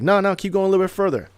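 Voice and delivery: no mercy, hurry him further out; insistent urging. Retold or imitated. Mentor refusing the drowning man.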